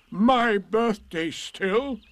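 loud, high, drawn-out donkey disbelief; Eeyore. A narrator's reading. Eeyore asks if Piglet means him, slow and astonished. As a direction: stretch it out in astonishment.

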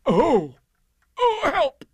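high, loud yelp for help; hammed-up high-pitched distress; alarmed cry. A narrator's reading. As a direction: cry out as if suddenly stuck. Pooh gets stuck in the hole.

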